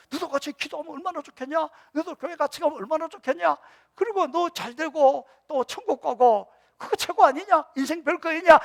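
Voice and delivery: high, loud rising plea; fervent exhortation. Acted. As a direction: preach it with mounting fervour. Fervent preaching climax. (점점 높고 크게 외치는 설교조 권면)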